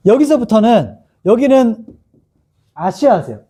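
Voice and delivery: normal talking voice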